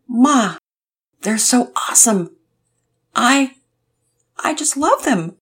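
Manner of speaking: voice breaking. A narrator's reading. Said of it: "Todd's voice broke".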